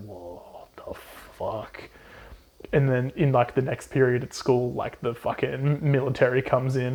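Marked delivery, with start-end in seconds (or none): 0.1-1.9 very low, very soft and slow dumbfounded voice; a drawn-out low mumble of disbelief; stunned crowd. Retold or imitated. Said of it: "teachers and students are just like".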